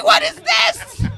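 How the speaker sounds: high, loud, slow outrage; mother shocked by the formula price; shocked shout. Retold or imitated. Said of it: "I was like".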